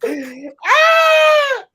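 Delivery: high, loud cry of laughter; laughing shriek. Real, not acted. Caught acting a fool on stream, laughing shriek. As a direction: let out a delighted shriek. Cracking up at himself.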